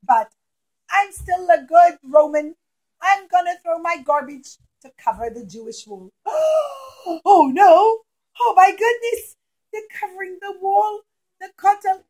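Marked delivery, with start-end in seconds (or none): 6.3-12.0 shocked cry. Acted. Yehuda discovers the buried wall.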